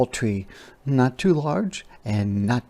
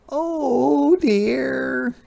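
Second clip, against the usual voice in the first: high, sad wail. A narrator's reading. Hedgehog despairs over her nest, high wail. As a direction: wail it like a worried little animal. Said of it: "wailed Hedgehog".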